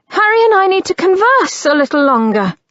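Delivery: fast, high and loud; yelling. Acted. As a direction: rush it out in a yell. Yelling at brother outside door.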